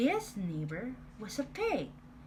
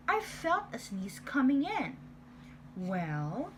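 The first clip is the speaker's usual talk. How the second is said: usual speaking voice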